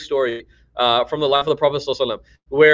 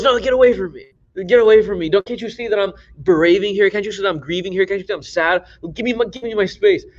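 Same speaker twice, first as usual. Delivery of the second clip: grieving woman pushing away; 6 semitones higher than usual. Retold or imitated. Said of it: "And so he goes".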